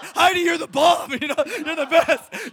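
high, loud, laughing praise; gushing fan cheer. Retold or imitated. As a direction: cheer your hero on, overexcited. Retold cheering for his hero.

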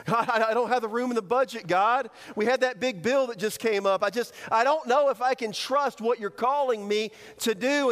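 worried, stammering justification; pleading excuses. Acted. Acting out budget excuses to God.

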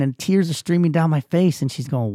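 ordinary delivery

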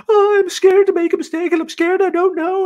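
very high, loud panicky imitation; mock freak-out. Imitating new coworker scared of mistakes, freaking out. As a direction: squeal it like a panicking trainee. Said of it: "she was freaking out, like".

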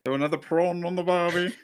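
put-on a quick jokey stab at an Australian accent; mock Australian accent. [name] tries the Australian line after being asked, mild mock Australian accent. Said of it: "Can you do an Australian accent?".

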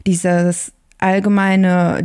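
ordinary talk, nothing marked

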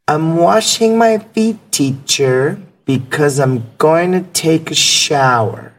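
put-on young Chinese student answering his teacher; eleven-year-old student. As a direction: talk like a little boy, slowly.

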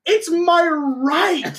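very high, slowly drawn-out proclamation; mock patriotic fervour. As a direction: stretch it out in hammed-up righteousness. Acting out a proud American hunter.

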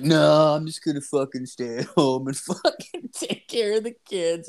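high-pitched mock refusal; imitating his buddy. Buddy turns down a job offer, imitated dismissive refusal. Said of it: "And he's like".

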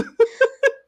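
very high helpless laugh; high squealing laugh. Real, not acted. Laughing hard.

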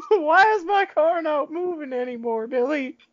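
put-on high, confused little old lady; put-on high old-woman voice; old lady impression. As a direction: play a baffled granny calling on someone for help.

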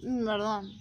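mumbled with food in mouth; apologizing mid-chew; mouth full. Real, not acted. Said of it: "Yo estoy comiendo algo".

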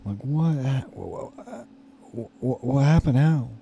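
stammering, pitch jumping up on each restart; flustered shock. Retold or imitated. Asking the boss what happened, stammering and shocked. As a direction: trip over the words in alarm.

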